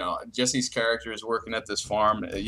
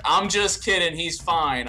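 imitated aunt; 6 semitones higher, 4 dB louder than usual. Aunt reveals prank in retold call.

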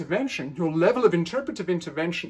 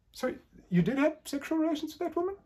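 high, quick rising question on have; questioning echo; 10 semitones higher, 4 dB quieter, faster than usual. Shown as a demonstration. Analyst to Clinton on the couch, doubting his denial. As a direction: toss the words back as a faster doubting question. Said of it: "an analyst is to say".

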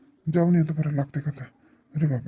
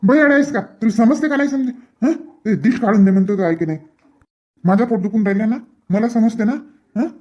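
loud, higher-pitched angry scolding; 5 semitones higher, 4 dB louder than usual. Acted. Annoyed husband scolds his laughing wife, loudly and higher. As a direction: snap at her for not listening.

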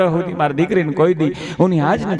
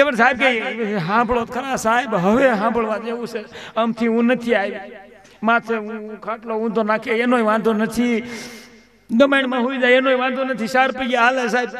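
higher, softer pleading wife voice; 7 semitones higher, 3 dB quieter than usual. Retold or imitated. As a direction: beg the doctor in a higher voice.

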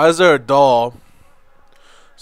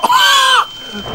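loud high-pitched scream-laugh; high shriek-laugh; 6 dB louder than usual. Real, not acted. Sudden scare in game.

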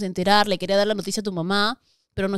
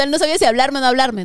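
ordinary talk

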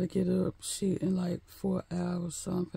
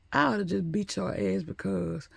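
ordinary talk

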